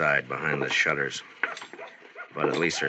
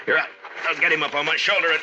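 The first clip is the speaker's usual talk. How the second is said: straining effort; 11 semitones higher, 4 dB louder, faster than usual. Acted. Hoisting body under fire.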